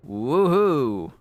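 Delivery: high, loud woohoo; playful celebratory whoop; mock cheer. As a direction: cheer it out big. Mock cheer over crafting result.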